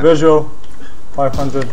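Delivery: loud, urgent target callout. Real, not acted. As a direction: call it out over gunfire. Calling out target in firefight.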